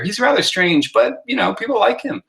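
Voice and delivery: high, lilting read with the rise on "people like him"; emphasis read aloud. Shown as a demonstration. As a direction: lift the voice high toward "people like him" at the end. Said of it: "the emphasis changes".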